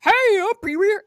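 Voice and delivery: high, loud, fast Pee Wee try; host attempts Pee Wee Herman, high and quick; Pee Wee Herman impression. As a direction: rush it out as a half-formed Pee Wee. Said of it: "And then Pee Wee Herman is like".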